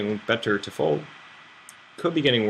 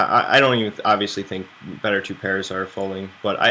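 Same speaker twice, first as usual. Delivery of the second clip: ordinary talk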